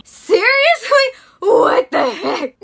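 loud, drawn-out high outrage; exasperated outcry. Retold or imitated. Reacting to the friend's demand, loud and exasperated. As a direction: let the disbelief burst out slowly.

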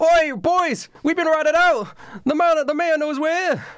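put-on rally the boys, we've been found out; pirate captain shouting. Captain calls to his crew.

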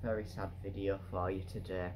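a hammed-up touch of sadness; mock sad. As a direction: put on a sorry-for-you voice. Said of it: "I'm getting emotional".

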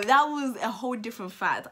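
ordinary talk, nothing marked

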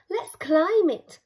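eager Viking call. Acted. Loggy urging the Vikings up the hill, eager. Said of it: "Loggy Longsocks said".

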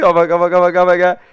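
loud, high rapid-fire repetition; frantic calling out. Real, not acted. Gamer in sudden in-game trouble, frantic loud chatter. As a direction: blurt it over and over at high volume. Panicked reaction during gameplay.

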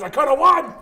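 loud, high-pitched mock outrage; retold howl of frustration; frustrated yell. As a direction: yell it at the guys who cost you the win. Acted frustrated yell at friends.

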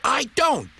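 little engine's squeaky denial; indignant Duck denial. A narrator's reading. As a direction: fire it back in the quarrel. Tit-for-tat quarrel.

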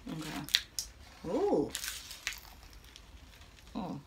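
talking while eating; biting into food. Real, not acted. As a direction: mouth partly full. Seen 0: Chopsticks hold the rangoon at her lips, biting throughout; heat not visible.

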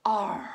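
loud breathy "Arr" on the out-breath; pirate sigh. Shown as a demonstration. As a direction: blow it out like a pirate. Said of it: "a big sigh going".

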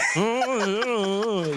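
high, wavering hummed run; wordless sung riff. Shown as a demonstration. Actor demonstrates his singing tricks to host, high wordless croon. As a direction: show off a little vocal flourish. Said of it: "You, see that?".

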